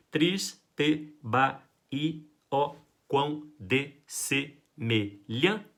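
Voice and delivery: "Triste Bahia, ó quão dessemelhante" split into beats, very slow with pauses; scanning syllables. Shown as a demonstration. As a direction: count the poetic syllables out slowly. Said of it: "Quantas sílabas poéticas vão ter?".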